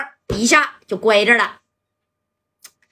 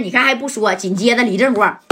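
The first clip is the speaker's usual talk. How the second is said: ordinary delivery, nothing marked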